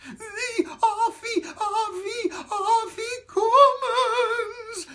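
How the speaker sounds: very high, slow, sniffling wail; sniffling squeak. Acted. Matzo man telling the children his family name, sniffling high wail. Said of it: "sniffed the matzo man".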